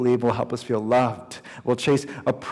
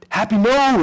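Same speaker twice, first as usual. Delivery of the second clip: higher, louder cry to stop a dog; alarmed shout; 10 semitones higher, 8 dB louder than usual. Retold or imitated. Owner shouting to stop his dog at the cliff edge, alarmed shout. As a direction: call out in sudden alarm.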